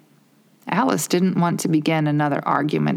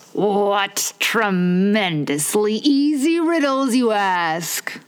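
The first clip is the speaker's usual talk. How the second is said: slow, hammed-up growl of a proud egg; a hint of a grumpy egg growling; mock growl; 4 semitones higher, much slower than usual. A narrator's reading. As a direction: drag the words out in a mild mock growl. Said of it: "Humpty Dumpty growled out".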